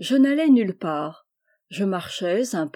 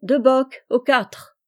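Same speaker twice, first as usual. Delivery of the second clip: waiter's loud relayed order, pitched up; raised voice passing an order along; distant voice repeating; 5 semitones higher, 6 dB louder, slower than usual. A narrator's reading. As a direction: echo the call across the room. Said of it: "Une voix lointaine répéta".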